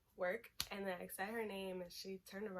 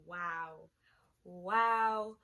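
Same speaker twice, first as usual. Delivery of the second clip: stunned, rising louder wow; shocked wow; 8 dB louder than usual. Retold or imitated. Girlfriend reacting to the cheating screenshot, stunned wow. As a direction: let the wow burst out in disbelief.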